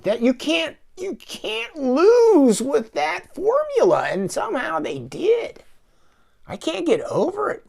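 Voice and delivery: squeaky slow outrage at the ruined formula; exasperated disbelief. Real, not acted. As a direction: whine it out in high-pitched disbelief. Exasperated complaint about bad film.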